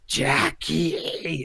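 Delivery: a lightly voiced sinister call of a name; hint of a creeping demon calling Jackie; sinister darkness voice. The Darkness calling out to Jackie, a lightly sinister voice. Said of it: "imagine if Freddy Krueger was less jokey and more, like, sinister".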